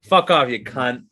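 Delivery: drawn-out, put-on Australian accent. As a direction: swear slowly like an Australian. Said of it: "that's when my Australian comes out".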